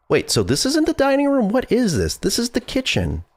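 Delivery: high, baffled questioning; confused surprise. Real, not acted. Confused by room layout.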